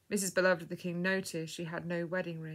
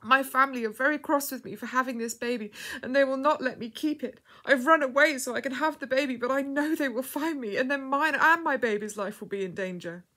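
higher, louder, urgent pleading; tearful distress; 6 semitones higher, 7 dB louder than usual. A narrator's reading. Frightened girl explaining to a stranger, tearful and urgent. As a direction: pour it out in anguish. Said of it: "burst into fresh tears".

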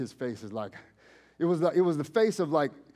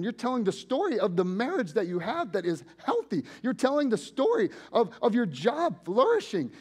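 loud, high, impassioned preaching; 8 semitones higher, 4 dB louder than usual. Acted. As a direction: drive it home to the congregation, full voice. Preaching at full force.

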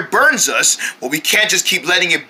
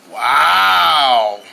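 awed; 5 semitones lower than usual. Acted. Awed at seeing the fire.

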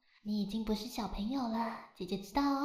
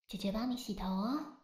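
ordinary talk

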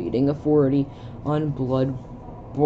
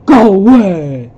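loud, slow, high yell; shouting go away; 5 semitones higher, 13 dB louder, slower than usual. Acted. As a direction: drive them off with a shout. Reads a character yelling.

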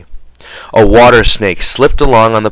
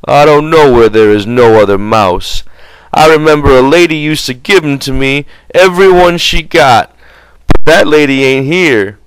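simple-minded big man; 6 semitones higher, 3 dB louder than usual. A narrator's reading. Lenny's voice in story.

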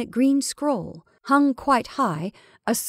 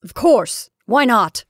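loud, stern retort; stern cry; 3 semitones higher, 8 dB louder, slower than usual. A narrator's reading. Caroline to her hesitant sister, stern cry. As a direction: come back sternly and forcefully. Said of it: "cried Caroline sternly".

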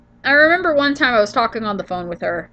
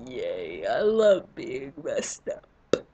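sarcastic cheer; 8 semitones lower, 8 dB quieter, much slower than usual. Real, not acted. Sarcastic about allergies.

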